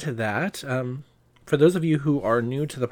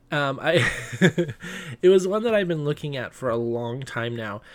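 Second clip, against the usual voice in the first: ordinary talk, nothing marked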